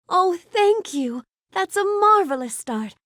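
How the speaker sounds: high, pleased exclamation; touched and delighted, pitched well up; delighted thanks. Acted. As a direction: gush gratefully over a gift. Thanks guest and praises start.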